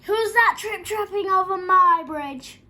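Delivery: huge, high, slow roar; full-force storybook troll roar. A narrator's reading. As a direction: bellow a drawn-out challenge as a fierce troll. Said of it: "roared the troll".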